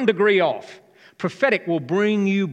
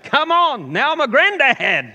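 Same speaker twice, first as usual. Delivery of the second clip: high, loud crowing with laughter; jubilant shout; 9 semitones higher, 8 dB louder, slower than usual. Real, not acted. Preacher to the congregation about being a granddad, jubilant shout. Joking exultation after the cheer.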